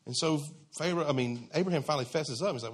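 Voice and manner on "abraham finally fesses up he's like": ordinary delivery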